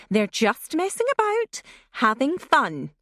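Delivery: put-on mother trying to sound jolly to calm the child; forced jocularity. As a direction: brush it off cheerily, barely hiding alarm. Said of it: "a jocular note that failed to disguise".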